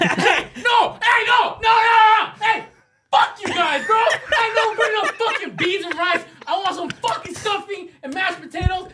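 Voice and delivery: high-pitched comic shouting; whiny yelled tantrum, hammed up; mock-outraged rant. Friend ranting about the Friendsgiving menu, in mock outrage.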